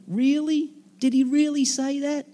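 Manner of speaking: high, teasing sneer of a serpent; high, taunting serpent doubting God's word to Eve; mocking serpent.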